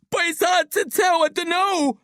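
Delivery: very high, distressed wail; sobbing shout. A narrator's reading. Billy can't tell what's around his legs, sobbing. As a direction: wail it, strained and high-pitched. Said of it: "Billy sobs".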